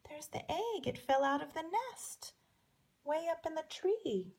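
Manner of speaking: put-on whisper-close to the children; showing children the fallen egg; hushed pointing out.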